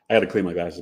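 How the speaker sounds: ordinary talk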